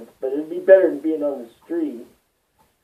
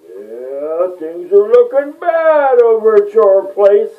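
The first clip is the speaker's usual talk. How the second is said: imitating attorney; 5 semitones higher, 8 dB louder, slower than usual. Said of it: "Some attorney called me and was like".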